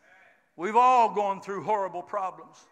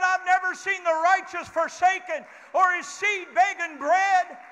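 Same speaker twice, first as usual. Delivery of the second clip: shouted scripture; 6 semitones higher than usual. Acted. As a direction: ring it out to the whole church. Preacher quoting David climactically.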